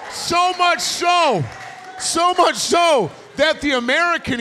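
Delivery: loud fired-up sermon climax; preaching shout. Real, not acted. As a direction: hammer the climax of the story. Preacher at full force, climax.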